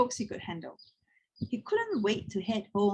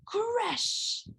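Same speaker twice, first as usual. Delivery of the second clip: dramatic crash; 13 semitones higher, 3 dB quieter than usual. A narrator's reading. Trolley crash in story.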